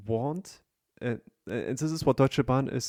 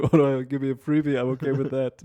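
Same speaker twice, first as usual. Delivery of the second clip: mocking retelling; 3 semitones higher, 6 dB louder than usual. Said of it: "friend mocks his story".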